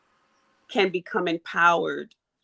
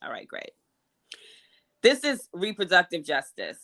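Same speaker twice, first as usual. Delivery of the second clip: normal talking voice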